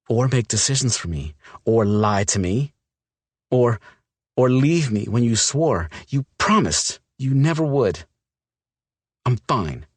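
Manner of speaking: hurt woman spilling her anger; upset young woman. Acted. Olivia's figure voice, upset.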